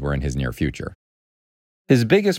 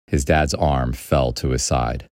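plain reading voice

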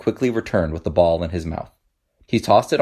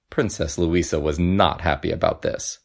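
ordinary delivery

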